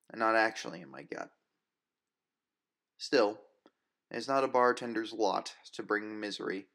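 plain reading voice

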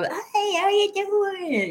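high, drawn-out mock drunken greeting; friendly drunk. Fan mimicking drunk showgoers greeting the ushers, friendly drunk. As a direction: imitate a happy drunk, stretching the words out. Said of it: "we're all drunk at the show".

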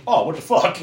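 a touch of baffled dismay; woozy disbelief. Retold or imitated. Monster as the drugs kick in, mildly hammed-up disbelief. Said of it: "He's like".

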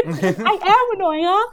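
mock confrontation. Mimics mom confronting her muttering.

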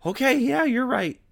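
very high, loud mock surrender; mom giving in. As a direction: squeak out a defeated yes. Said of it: "and my mom's like".

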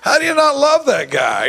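high and loud, bursting with fondness; delighted exclamation. Real, not acted. To the audience about loving his sponsee, a high, loud delighted exclamation. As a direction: exclaim it with real delight, pitch way up.

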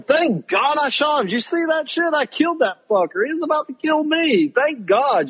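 high, hammed-up victory crowing; mocking how she should react, high and gloating; mock triumphant. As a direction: crow like you just won the fight. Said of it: "You should be going".

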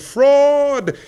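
high, loud ringing cry; echoing shout. A narrator's reading. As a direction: throw it out like an echo across the field. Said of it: "and the echo answered".

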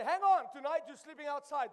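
loud, hammed-up telling off; mock scolding. Father scolds his muddy children, mock angry and loud. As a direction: play the cross dad, loudly.

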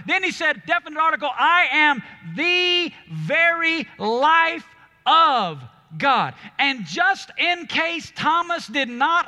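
loud, high, driving sermon delivery; fiery pulpit preaching to the congregation; full-force preaching. Acted. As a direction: preach it with everything, building to a roar.

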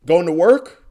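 loud, high cheery call across the lot; mock neighbor yelling a greeting. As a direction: sing it out like a nosy neighbor. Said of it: "He yells out".

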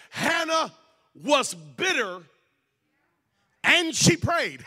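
high, loud, spaced-out proclamation; emphatic preaching. Real, not acted. Preacher proclaiming Hannah's story from the Bible, emphatic and loud. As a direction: punch each phrase with long pauses.

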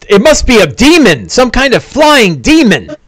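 a dwarf spotting a demon; Barundar alarmed. Acted. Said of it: "Barundar's like".

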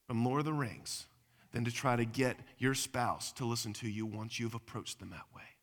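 usual speaking voice

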